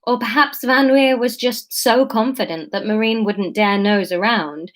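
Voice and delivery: ordinary delivery, nothing marked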